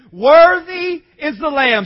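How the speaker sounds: loud, slow, stretched-out proclamation; drawn-out pulpit cry; multitude's proclamation. Shown as a demonstration. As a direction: proclaim it like a vast crowd in worship. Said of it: "Say it with me".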